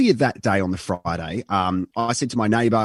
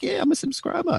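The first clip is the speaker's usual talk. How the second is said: much higher mock-wife voice; imitating his wife. Husband mimics his wife claiming she subscribes, mock high voice. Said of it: "and she's like".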